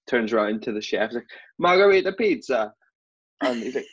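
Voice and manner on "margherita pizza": calling to the kitchen. Retold or imitated. Belfast waiter calls to chef.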